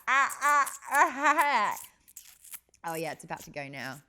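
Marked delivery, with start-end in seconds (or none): 0.1-2.9 mock dentist-chair whimpers. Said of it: "I'm like".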